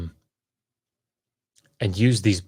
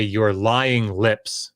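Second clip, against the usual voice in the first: slow, drawn-out imitation; mock misogynist quote; much slower than usual. Clubhouse misogynist to a woman, slow drawn-out mimicry. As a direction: drag each word out mockingly. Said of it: "he would say".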